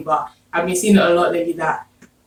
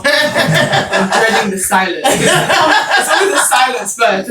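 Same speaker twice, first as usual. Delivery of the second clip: talking through laughter, drawn out and higher; laughing recollection; 5 semitones higher, much slower than usual. Retold or imitated. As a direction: laugh your way through the retold dread. Retelling register dread, laughing.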